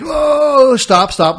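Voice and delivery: the DJ halting the crowd mid-song, loud; retold shout. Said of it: "He's like".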